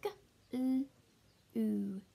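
"g-" and "ooh" as separate sounds; short "g-", pause, held "ooh"; sounding out. Shown as a demonstration. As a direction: segment it for early readers. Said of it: "the last one is".

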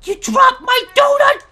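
loud, high indignant shout; mock fury. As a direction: yell in outrage over a lost treat. Confronting passer-by over donut.

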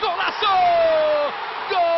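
high, loud goal scream; goal shout. Real, not acted.

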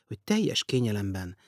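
ordinary delivery

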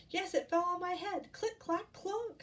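high, excited chick voice; eager little storybook chick; Chicken Little. Acted. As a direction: pipe up high and alarmed. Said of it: "said Chicken Little".

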